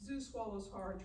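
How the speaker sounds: normal talking voice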